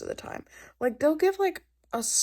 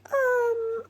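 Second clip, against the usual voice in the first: awkward um. Retold or imitated. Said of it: "she was like".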